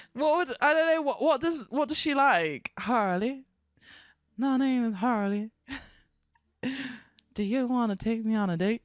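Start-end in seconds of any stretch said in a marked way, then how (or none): 2.8-8.8 put-on Southern belle accent. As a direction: introduce yourself as a Southern belle puppet. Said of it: "She's a Southern belle".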